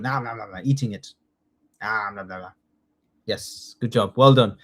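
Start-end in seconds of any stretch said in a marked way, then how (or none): 0.0-0.6 eating noises. Retold or imitated. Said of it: "picking up the deer and going". 1.8-2.5 eating noises. Retold or imitated. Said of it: "a dragon is coming down and picking up the deer and going".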